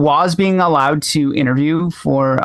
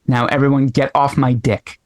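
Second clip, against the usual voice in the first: ordinary talk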